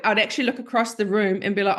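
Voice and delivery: ordinary talk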